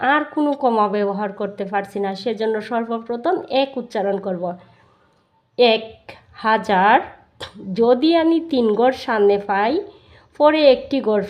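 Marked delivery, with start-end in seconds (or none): none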